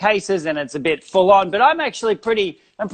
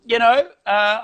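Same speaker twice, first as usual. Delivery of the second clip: ordinary talk, nothing marked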